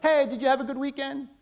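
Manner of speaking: bright, high, quick hallway hello; a light, perfunctory hi to a colleague; breezy passing greeting. Pretend. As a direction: toss it off in passing so you can get back to work. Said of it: "and you'll be like".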